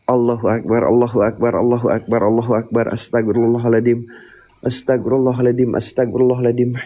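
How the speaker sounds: frightened man reciting dhikr for protection; murmured prayer. Real, not acted. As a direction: pray it over and over, low and even. Repeated prayer under threat.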